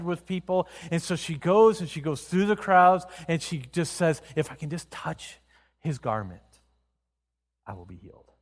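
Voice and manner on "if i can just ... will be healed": hushed hope. Acted. Woman hoping to touch his garment.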